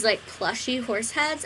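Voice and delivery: normal talking voice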